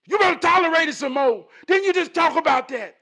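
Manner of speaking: loud, high-pitched telling-off; scolding wife. Retold or imitated. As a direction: imitate a wife firing back loudly.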